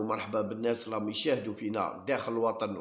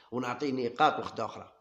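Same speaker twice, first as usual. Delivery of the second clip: normal talking voice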